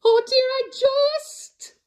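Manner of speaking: high, loud frightened refusal; panicked shout. Acted. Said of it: "shouted Kevin".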